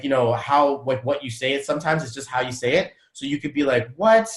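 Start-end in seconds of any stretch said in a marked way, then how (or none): 4.0-4.3 shocked. Shown as a demonstration. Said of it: "be in shock and disappointment".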